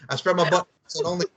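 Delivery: fast, hammed-up Mike Honcho line; Mike Honcho impression. Host quoting Talladega Nights to cohost, fast mock voice. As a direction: rattle it off as the mock porn star. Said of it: "as Mike Honcho".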